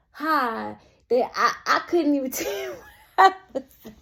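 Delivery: breaking up laughing mid-sentence; real laughter. Real, not acted. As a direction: try to get it out through laughter. Cracks up at her own story.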